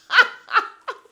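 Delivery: high, loud burst of real laughter. Real, not acted. Host cracks up at the castmate's jab, laughing loudly.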